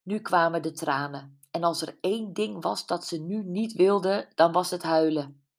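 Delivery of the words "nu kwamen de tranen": ordinary delivery, nothing marked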